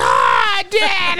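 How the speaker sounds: high, loud mock wail; imitated crying daughter. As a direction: imitate a child wailing for a parent. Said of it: "You ain't cried at all?".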